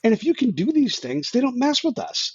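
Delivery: high, excited dawning; eager realization. Retold or imitated. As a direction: sound like the penny just dropped. Realizing basic training is simple.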